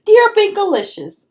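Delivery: high, loud bright elf greeting; elf's letter voice. Acted. Elf writes to Pinkalicious, high cheerful elf voice.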